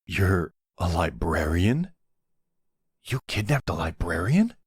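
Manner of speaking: can't believe what you just found out; incredulous disbelief. Acted. Boss learning they kidnapped a librarian, incredulous. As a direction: question climbing steeply on "librarian".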